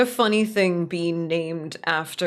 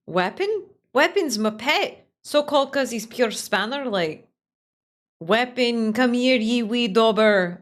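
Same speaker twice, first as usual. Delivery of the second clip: broad Scottish character voice, a bit higher; Scottish accent; 4 semitones higher, 4 dB louder than usual. Acted. Streamer voicing the Scottish character introducing his pet, Scottish accent. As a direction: play it as a Glaswegian local. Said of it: "Like the Scottish accent".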